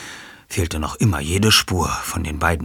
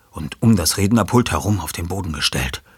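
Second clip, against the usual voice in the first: ordinary delivery, nothing marked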